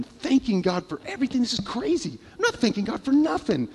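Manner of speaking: high, exasperated protest; exasperated at God. Retold or imitated. Preacher mocking his own gripe at God. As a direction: throw up your hands and gripe at God. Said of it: "And I'm telling God".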